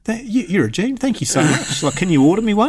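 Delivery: put-on high, over-grateful old man; gushing old bloke. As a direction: mimic the client's sudden praise.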